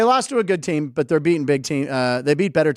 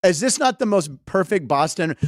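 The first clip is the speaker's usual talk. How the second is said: normal talking voice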